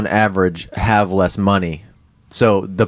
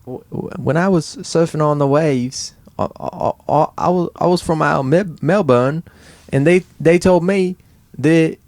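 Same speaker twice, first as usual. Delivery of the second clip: higher-pitched Australian accent; Australian accent attempt; 6 semitones higher than usual. Shown as a demonstration. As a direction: try an Australian accent, stumbling. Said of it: "difference between English and Australian".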